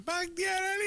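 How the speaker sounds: high drawn-out yell of a son's name; calling out a name. Retold or imitated. Mother summoning her son by name, high yell. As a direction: holler the name like an angry mother.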